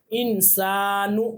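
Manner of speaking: इंसानु drawn out very slowly with a rising end; slow recitation. Shown as a demonstration. Teacher introducing a Quranic word, slow recitation. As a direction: recite इंसानु long and deliberate. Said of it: "तो यहाँ पर हमने मिलाकर पढ़ा".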